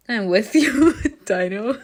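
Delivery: laughing through the words; giggling, can barely speak. Real, not acted. Breaks up laughing.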